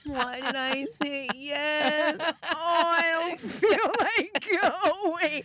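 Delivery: high, loud, slow tired whine; hammed-up exhausted complaining; mock whining. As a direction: moan about being too tired to go. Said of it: "this is the side that I hear".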